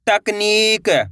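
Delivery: तकनीक stretched, with a long held नी; sounding out. Shown as a demonstration. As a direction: draw out तकनीक for learners to repeat. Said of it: "मेरे साथ साथ इनको repeat". (तकनीक, नी को लंबा खींचकर)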